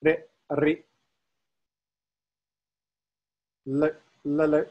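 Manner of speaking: chanted "re" and "le", a quick tongue touch and release; short syllable, then its longer twin, on a level note; touch-release tones. Shown as a demonstration. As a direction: demonstrate "re" and "le" for repetition. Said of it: "less frequently used touch release tones".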